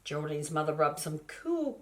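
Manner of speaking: ordinary delivery, nothing marked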